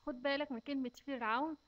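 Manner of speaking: normal talking voice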